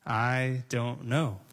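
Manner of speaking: drawn-out "I don't know." said calmly and slowly; slow, unafraid admission. Shown as a demonstration. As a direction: stretch "I don't know." out, soft and unhurried. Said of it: "he's not afraid to say".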